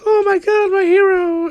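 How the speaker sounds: very high, loud swooning squeal; squeaky gushing girl. Pretend. Girl character swooning over her hero, high squeal. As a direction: gush like a starstruck fan.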